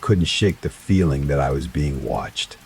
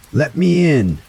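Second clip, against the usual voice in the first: high, louder furious scream; 11 semitones higher, 5 dB louder than usual. A narrator's reading. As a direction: scream it, nearly growling. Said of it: "the voice screamed again, now sounding almost like a growl".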